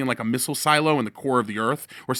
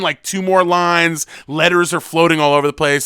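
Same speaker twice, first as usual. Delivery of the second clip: usual speaking voice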